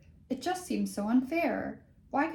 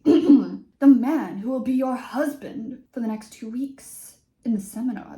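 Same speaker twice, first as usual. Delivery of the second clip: dramatic British teacher; 3 semitones higher, 11 dB louder than usual. Acted. Teacher dramatically announcing husbands in a British accent. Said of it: "cleared his throat dramatically".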